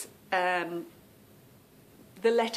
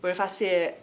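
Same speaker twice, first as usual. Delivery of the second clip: ordinary delivery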